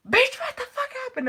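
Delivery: high, loud alarmed outburst; shocked friend. Retold or imitated. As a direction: blurt it in high disbelief. Friend Amina quoted.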